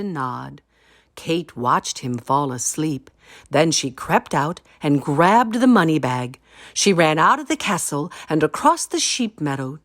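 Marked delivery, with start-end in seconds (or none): none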